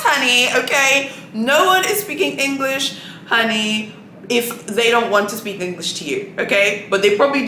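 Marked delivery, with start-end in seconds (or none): none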